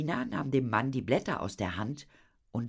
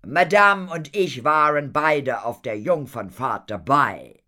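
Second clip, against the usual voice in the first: loud, raised, delighted announcement; beaming pride; 5 semitones higher, 11 dB louder than usual. A narrator's reading. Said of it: "strahlte Horatio". (strahlend und laut)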